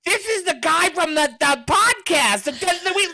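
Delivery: high-pitched, excited holler; imitated yell across an office, voice way up. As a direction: call it out to coworkers across the room, imitating him. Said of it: "He yells across the office".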